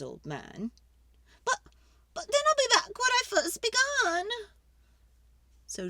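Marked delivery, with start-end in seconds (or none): 1.4-4.3 put-on fretful old lady. Old lady begging the wise man for help, high and pleading.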